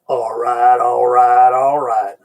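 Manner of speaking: drawled mock all right; a hint of McConaughey's famous drawl; McConaughey catchphrase. Recalling the Texas senator hopeful, he mimics his catchphrase. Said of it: "Matthew McConaughey".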